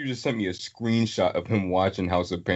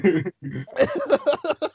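high laugh. Real, not acted. Laughing at the bit.

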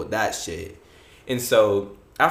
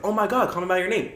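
higher-pitched retold excitement; acted excitement; 7 semitones higher, faster than usual. Teen to seatmates about movie choice, acted excitement. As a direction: re-enact an eager little discovery. Acts own eager reaction to suggestion.

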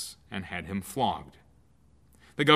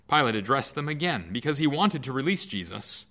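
usual speaking voice

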